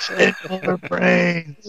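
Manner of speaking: drawn-out groaning zombie voice; slow zombie moan. Retold or imitated. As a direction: drag the words like a hungry zombie. Joke quote of zombie dispatcher.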